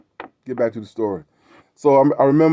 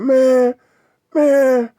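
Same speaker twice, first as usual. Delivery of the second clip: much higher, louder, choked-up mock sob on man, man; retold tearful plea, pitched way up; imitating a crying man; 12 semitones higher, 4 dB louder than usual. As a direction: voice him close to tears, straining up high. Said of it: "this man was in tears".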